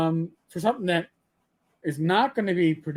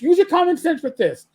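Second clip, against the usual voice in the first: higher and louder, pressing the point; louder insistence; 8 semitones higher, 6 dB louder than usual. Real, not acted. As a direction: repeat it harder, almost shouting. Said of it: "Listen, use your common sense".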